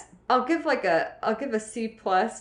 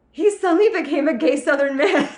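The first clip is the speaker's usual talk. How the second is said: high, loud, laughing through it; can't keep a straight face; amused, laughing while telling the story; 8 semitones higher, 7 dB louder than usual. Real, not acted. Retelling a funny moment.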